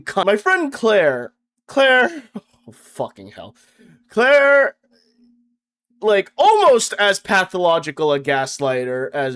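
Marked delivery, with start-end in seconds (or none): 4.1-4.8 exasperated call. Acted. Calling exasperated friend's name.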